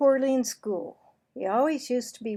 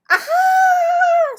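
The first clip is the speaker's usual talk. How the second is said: very high, loud disgusted scream; girl's scream; 17 dB louder than usual. Acted. Heather screams as Marvin bites his sandwich, very high loud shriek. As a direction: shriek in horror. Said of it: "screamed Heather".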